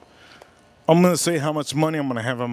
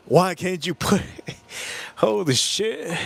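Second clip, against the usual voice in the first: real tears breaking off the words; overcome, on the edge of crying; choked up; 4 semitones higher than usual. Real, not acted. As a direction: get choked up mid-sentence and give up. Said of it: "that brought tears to my eyes".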